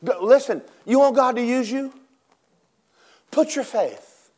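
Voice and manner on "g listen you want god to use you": ordinary talk, nothing marked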